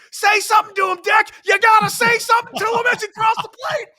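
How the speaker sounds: very high, loud excited shout. Retold or imitated. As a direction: holler it across the field.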